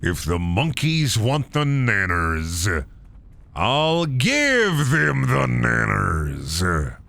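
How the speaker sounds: very slow, drawn-out delivery; hammed-up character voice. Gamer declaring banana gift, slow hammed-up voice. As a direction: stretch every word like a grand silly proclamation. Slow performed silly line.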